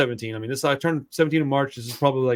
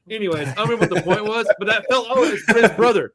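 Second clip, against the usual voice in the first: usual speaking voice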